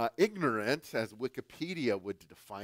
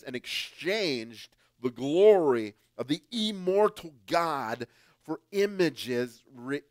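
loud, slow and solemn reading of the verse; scripture reading; 2 semitones higher, 7 dB louder, slower than usual. Acted. Preacher reading scripture to the congregation, loud and slow. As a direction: proclaim each word slowly, like holy writ.

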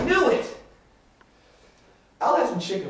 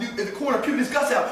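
ordinary delivery

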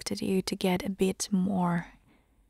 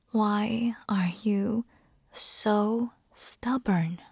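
very slow, each word stretched out; slow, exasperated plea with STUBBORN held long; drawn-out exasperation; much slower than usual. Acted. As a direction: drag it out at a stubborn eater. Coaxing a refuser, sighs first.

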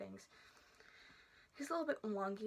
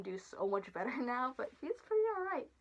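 muffled inside costume head; speaking behind padded mask; through a mascot head; 3 semitones higher, 5 dB louder than usual. Real, not acted. Seen 5: Full costume head covers her face and mouth throughout the words.

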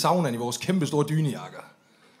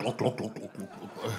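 gluk sound; 7 semitones lower, 12 dB quieter than usual. Retold or imitated. Imitating liquor pouring.

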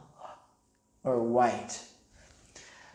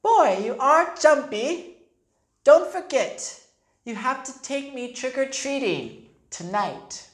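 put-on high, loud little girl voice; bossy little sister; 8 semitones higher, 3 dB louder than usual. A narrator's reading. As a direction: tease her brother, then insist. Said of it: "said his little sister D W".

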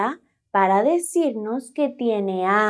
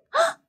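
a very high surprised "ah"; surprised gasp. Acted. Noticing two blues for the children, high surprised gasp.

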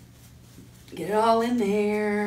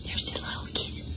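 soft, whispery hush; don't wake the sleeping kitty; hushed, slowing storytime voice; playful hush; 10 dB quieter than usual. Pretend.

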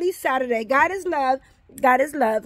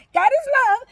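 God is love said much higher and louder; emphatic proclaim; 10 semitones higher, 5 dB louder, faster than usual. Real, not acted. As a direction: lift the middle "is" up high and hard. Repeated blessing, raised take.

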